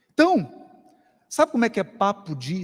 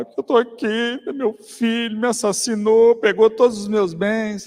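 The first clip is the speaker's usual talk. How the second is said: higher, louder, a hammed-up grievance; aggrieved complainer; 5 semitones higher, 7 dB louder than usual. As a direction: play the wronged man airing his woes. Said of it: "Aí o sujeito fala".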